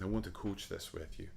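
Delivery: softly imitated Scottish accent; quiet, low, lightly Scottish; gentle Scottish accent. Conductor offering to coach a singer, gentle Scottish accent. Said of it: "In his gentle Scottish accent".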